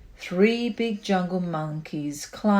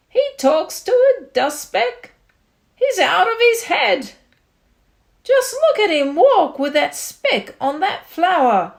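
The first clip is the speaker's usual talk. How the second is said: higher, louder mocking chatter; jeering jungle crowd; 8 semitones higher, 8 dB louder than usual. A narrator's reading. Jungle animals spreading gossip, loud mocking jeers. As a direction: jeer at the mad elephant.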